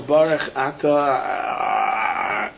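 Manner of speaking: very slow, stalling into a stuck drone; acted stage fright. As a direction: freeze up on the blessing and drag it out. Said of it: "stage fright, he forgets everything".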